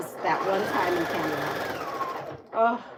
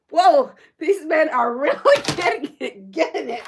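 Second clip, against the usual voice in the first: laughing amazement; 7 semitones higher, 6 dB louder than usual. Retold or imitated. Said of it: "I was like".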